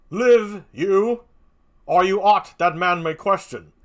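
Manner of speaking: stern, loud questioning; stern Banquo. Acted. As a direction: demand answers of the witches. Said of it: "Banquo, a stern voice".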